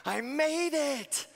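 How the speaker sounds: put-on a small kid claiming his triumph; proud little boy. Nephew proudly announcing his arrival to the whole plane. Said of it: "and he's like".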